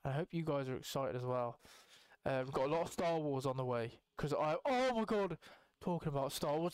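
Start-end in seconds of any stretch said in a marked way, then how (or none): none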